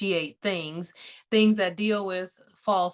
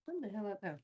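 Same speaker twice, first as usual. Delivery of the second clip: much softer, under the breath; muttered aside; 14 dB quieter, much faster than usual. Real, not acted. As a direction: drop it to a quiet aside to yourself. Puzzled aside while filming.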